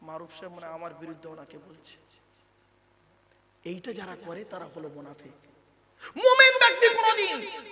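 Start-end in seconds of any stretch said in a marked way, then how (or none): 0.0-2.0 softer, lower grumbling aside; suspicious mutter. Retold or imitated. Hypocrite muttering to a neighbour, mock suspicious mutter. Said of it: "দু একজন ভাববে". 6.2-7.7 much higher, much louder ringing cry; emphatic shout. Acted. Preacher stressing the believer's conduct, loud ringing shout.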